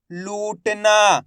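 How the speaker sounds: "लूटना" very slow, long "oo" and long final "aa"; drawn-out reading. Shown as a demonstration. Teacher blending letters into a word for learners, drawn out. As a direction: stretch both long vowels in "लूटना". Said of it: "तो खींच के पढ़ेंगे".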